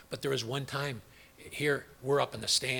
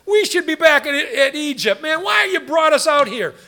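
high, loud whiny complaining; mock whine, high and loud; whining Israelites; 11 semitones higher, 11 dB louder than usual. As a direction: whine like a griping crowd. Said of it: "whining and complaining".

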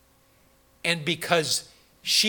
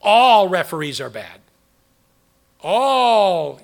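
mock outburst; 2 semitones higher, 12 dB louder, slower than usual.

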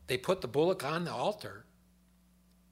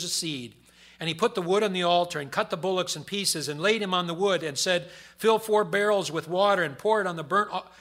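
loud, higher booming scripture reading; preaching scripture; 5 semitones higher, 10 dB louder than usual. Acted. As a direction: proclaim it from the pulpit. Reads scripture at full force.

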